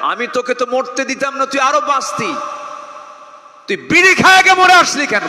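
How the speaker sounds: high accusing voice building to a shout; acted stern reproach. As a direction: confront the sinner, ending at full volume. Acted divine reproach in a sermon. (চিৎকারে গড়ানো ভর্ৎসনা)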